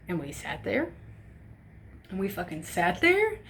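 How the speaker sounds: usual speaking voice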